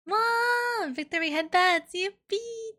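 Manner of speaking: high squealy delight; excited cheer. Real, not acted. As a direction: squeal with joy at the win. Cheering a win.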